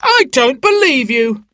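high, outraged heckle; indignant high-pitched shout; shouted disbelief. A narrator's reading. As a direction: call out your accusation from the crowd. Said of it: "shouted a woman".

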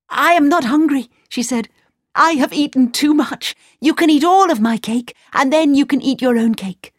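high, quick princess voice; bright and insistent. A narrator's reading.

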